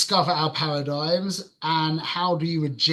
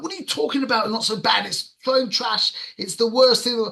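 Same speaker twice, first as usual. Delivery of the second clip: high-pitched indignant comeback; angry retort; 7 semitones higher than usual. Retold or imitated. Arguing with friend's words in his head, angry. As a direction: re-enact a worked-up, exasperated protest.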